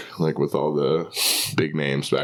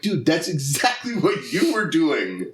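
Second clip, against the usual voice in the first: retold incredulous retort; 9 semitones higher than usual. Retold retort to hypocritical heckler.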